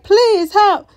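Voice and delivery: high, loud mock begging; desperate plea. Elgin begging to get inside, mock desperate plea. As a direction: imitate a man begging for rescue.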